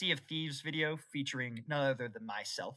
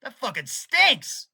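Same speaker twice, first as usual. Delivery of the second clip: slow, loud, higher disgusted groan of a voice; disgusted dude; 6 semitones higher, 9 dB louder, much slower than usual. Retold or imitated. Meme guy reacting to a stink, loud and disgusted. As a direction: voice the grossed-out guy drawn out. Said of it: "He's like".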